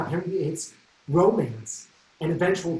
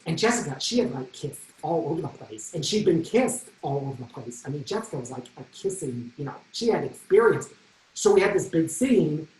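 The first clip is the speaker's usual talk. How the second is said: usual speaking voice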